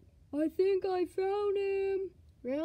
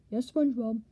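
ordinary talk, nothing marked

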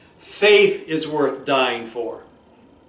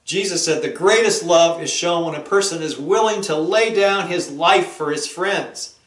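normal talking voice

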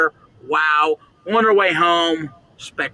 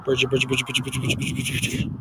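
low, soft repeated muttering; anxious muttering; 5 semitones lower, 7 dB quieter, much faster than usual. Real, not acted. Stranded alone, repeating the friend's name, anxious mutter. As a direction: mutter it over and over, fading away.

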